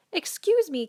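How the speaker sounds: a light, lifted little cricket voice; polite cricket. Acted. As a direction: pipe up politely like a tiny insect. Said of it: "coughed a cricket".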